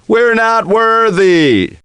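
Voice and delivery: high, slow, chanted bowing cry; hammed-up chant of adoration; mock worship again. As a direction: grovel theatrically before the champ.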